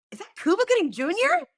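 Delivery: high incredulous question, reenacted; astonished celebrity-spotting question; starstruck disbelief. Retells spotting a celebrity at bar.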